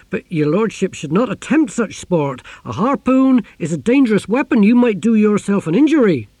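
loud, high, anxious protest; protesting captain. A narrator's reading. Captain warning his lordship off the harpoon, loudly protesting.

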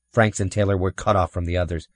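ordinary delivery, nothing marked

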